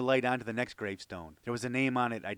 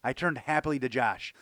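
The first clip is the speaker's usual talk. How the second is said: usual speaking voice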